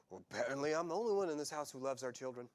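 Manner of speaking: ordinary talk, nothing marked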